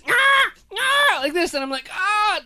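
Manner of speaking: high yelping cries; mock pained yelp. Dad reenacting baby clawing him, high yelps. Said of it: "kung fu death grip".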